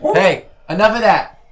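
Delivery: scolding the dog. Real, not acted. Host scolding the barking dog, loud and sharp. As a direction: snap at the dog to stop.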